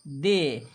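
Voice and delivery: "de" slow and stretched; demonstrating "de". Shown as a demonstration. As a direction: sound out "de" for learners. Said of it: "दा के साथ एक का उच्चारण".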